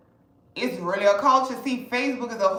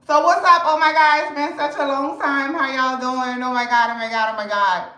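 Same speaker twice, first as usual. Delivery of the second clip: louder, higher, bursting hello to viewers; excited greeting; 3 semitones higher, 4 dB louder than usual. Real, not acted. Vlogger welcoming back viewers after a long absence, excited loud greeting. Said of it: "I'm hollering".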